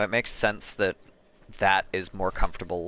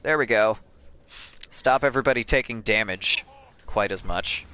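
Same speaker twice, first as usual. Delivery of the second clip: normal talking voice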